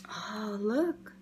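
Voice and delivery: breathy hushed aside; whisper. Real, not acted. Reader pointing out puppy's mama, whispered. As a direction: whisper it like sharing a secret.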